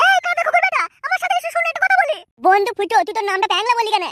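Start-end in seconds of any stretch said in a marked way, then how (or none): 0.1-2.2 higher, bossy summons of a servant; scolding call. Acted. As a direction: bark the insult, then order him over.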